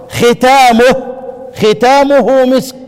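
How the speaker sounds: slow, drawn-out ختامه with kasra on kh; teaching the reading ختامه slowly; reading khitamuhu. Shown as a demonstration. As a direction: spell out ختامه syllable by syllable. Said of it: "يقيد الايه؟ القراءة الأخرى".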